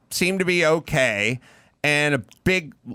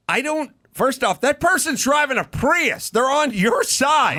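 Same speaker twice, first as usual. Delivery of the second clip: pitched way up, pleading disbelief; incredulous outrage; 8 semitones higher than usual. Real, not acted. Ranting about the driver attacking a Prius, high outraged disbelief.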